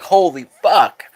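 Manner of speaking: slow, awed "Holy fuck"; drawn-out amazed disbelief; stunned fan. Retold or imitated. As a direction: let it sink in like a shocked fan. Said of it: "They'd be like".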